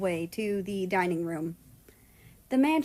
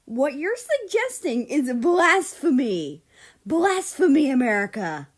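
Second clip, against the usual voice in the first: outraged; 5 semitones higher, 6 dB louder than usual. A narrator's reading. Mexico outraged at America's taco claim. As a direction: cry blasphemy with theatrical fury.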